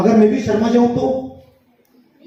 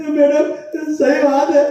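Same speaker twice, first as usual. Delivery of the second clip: mock whine; 5 semitones higher than usual. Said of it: "तीनों बच्चे रोते रोते बोले".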